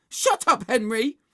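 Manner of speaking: high, loud retort; angry shout. Acted. Margaret answers Henry over the wall, angry shout. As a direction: silence a heckler sharply.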